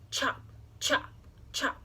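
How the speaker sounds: breathy sound-effect chops; chopping sounds. A narrator's reading. Narrator mimicking the axe biting wood, breathy chops. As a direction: chop it out like axe blows.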